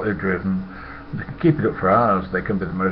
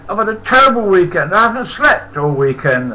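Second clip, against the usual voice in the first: high, loud, overwrought mimicry; imitated panicky high-pitched pleading; worried Huey; 9 semitones higher, 7 dB louder than usual. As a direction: wail it out high as a fretting workmate. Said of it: "And Huey came dashing up".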